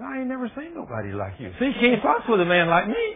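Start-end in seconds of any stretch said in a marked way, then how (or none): 0.0-1.5 amazed man. Retold or imitated. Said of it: "He said".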